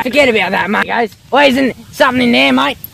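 high and loud, urging; excited shout. Real, not acted. Mates at the creek, yelling excitedly at a fish. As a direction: spot the fish and yell for him to go.

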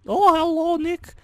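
high cackly witch voice. Acted. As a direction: answer like a sweet old witch. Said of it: "used the witch voice".

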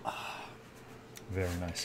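normal talking voice

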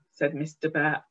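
ordinary delivery, nothing marked

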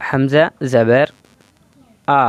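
Hamza জাবার আ, with a short unstretched আ; teacher reading আ quick, no drawing out; short clipped a. Shown as a demonstration. Teacher showing students the short fatha sound. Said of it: "এখানে কোনো টান হবে না".

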